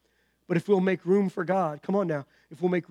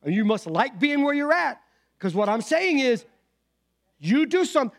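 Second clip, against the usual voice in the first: higher and louder exasperated Jesus voice; Jesus challenging; 6 semitones higher, 4 dB louder than usual. Retold or imitated. Jesus pressing the lame man, higher, louder and exasperated. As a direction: push back hard, half scolding.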